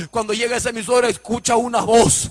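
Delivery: fervent chanted sermon cadence; full-force preaching. Acted. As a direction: preach it out, half singing to the congregation.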